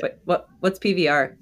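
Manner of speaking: ordinary talk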